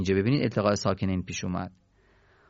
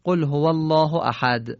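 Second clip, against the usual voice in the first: raised recited tone, Surah Tawhid opening; Quran recitation; 4 semitones higher than usual. Shown as a demonstration. Teacher reciting Surah Tawhid to the class, chanted.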